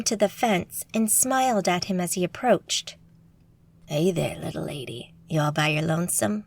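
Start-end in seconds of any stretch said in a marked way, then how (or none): 3.9-6.4 lower, smooth cowboy voice for Troy; cowboy flirting. A narrator's reading. Cocky cowboy Troy chatting up a stranger, lower and smooth. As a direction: lean in and chat her up, low and easy.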